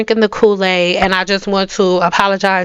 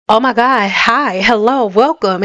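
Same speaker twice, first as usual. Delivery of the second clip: ordinary delivery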